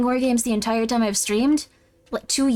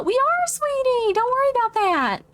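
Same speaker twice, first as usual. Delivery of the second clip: reassuring mom; 9 semitones higher than usual. Pretend. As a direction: reassure sweetie in a high, stretched-out voice. Mom figure reassures sweetie.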